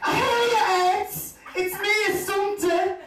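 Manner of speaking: high, loud shrieking greeting; excited woman shrieking hello across a room; loud shout. Retold or imitated. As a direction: yell it across the restaurant at him. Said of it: "she lets out the biggest shout".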